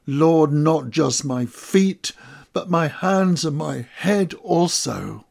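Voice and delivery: an earnest cry of devotion. Acted. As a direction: call out with urgent longing. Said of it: "And I cry out".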